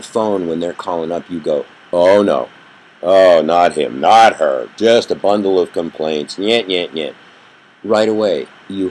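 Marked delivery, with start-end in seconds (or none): 1.9-7.1 louder groaning refusal; a mock groan at an unwanted call; exasperated dread. Seeing a complainer's caller ID, loudly exasperated.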